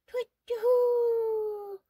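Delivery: loud high hoot of fright; shrieking owl cry; panicked owl hoot. Acted. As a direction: hoot and flee.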